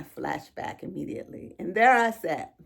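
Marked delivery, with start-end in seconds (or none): 1.6-2.5 pushing the words out through rising emotion; getting emotional. Real, not acted. Mother recalling her sons at Costco, fighting back tears. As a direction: voice lifting and straining as tears come. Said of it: "I'm probably getting emotional right now".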